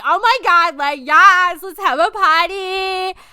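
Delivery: mock party cheer from friends; excited friends. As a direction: cheer like friends at good news. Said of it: "my friends were like".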